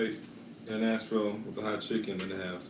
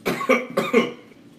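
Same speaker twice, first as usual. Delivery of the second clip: harsh loud coughing fit; spice cough; 13 semitones higher, 9 dB louder than usual. Real, not acted. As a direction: cough from the heat. Coughing from hot chicken.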